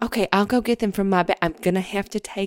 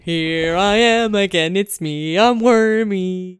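slow, louder silly worm; worm puppet voice; 6 dB louder, slower than usual. Acted. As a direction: bob along as a cheerful worm. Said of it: "it's a hand puppet".

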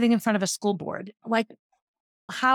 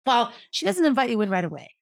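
ordinary delivery

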